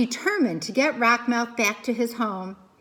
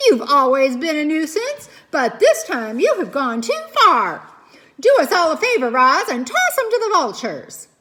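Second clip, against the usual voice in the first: loud, raised angry voice; furious scolding; 5 semitones higher, 8 dB louder than usual. Acted. Mother beaver scolds the pike and demands Roz toss him. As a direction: tear into the pest, fed up.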